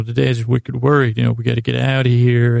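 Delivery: ordinary delivery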